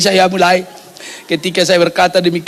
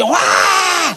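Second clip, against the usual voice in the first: high imitated baby scream; mimicked shrieking newborn; baby's scream; 8 semitones higher than usual. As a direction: let out a rising "waah" wail. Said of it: "teriak dia".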